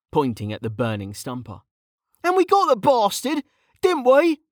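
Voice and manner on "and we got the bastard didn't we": high-pitched self-justifying appeal; protesting. A narrator's reading. Mage pointing at the burning monster, protesting. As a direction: argue your case in a high voice. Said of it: "Kettle protested".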